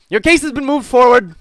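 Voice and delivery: loud, high official shout; called-out announcement. Acted. Modron telling petitioner of case, shouted announcement. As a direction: announce it loudly across the hall.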